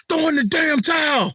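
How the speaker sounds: high, shouted mock corner-man yell; mock trainer shout. Telling the beaten kid to quit, in a mock shout. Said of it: "I'm like my man off, uh, Rocky".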